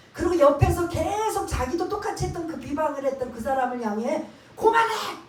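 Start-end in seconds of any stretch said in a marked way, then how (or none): 4.5-5.2 sharp high yell to make him stop; scolding shout. Retold or imitated. Repentant thief shouting down the other thief, scolding. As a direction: bark it out loud and high, scolding. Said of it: "지금 꾸짖는 거예요".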